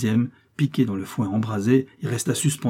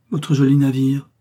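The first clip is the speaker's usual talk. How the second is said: louder, higher cry of dismay; regretful exclamation; 4 semitones higher, 7 dB louder than usual. A narrator's reading. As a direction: exclaim with regret over a loss. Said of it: "s'écria Rubin, plein de regret". (exclamation pleine de regret)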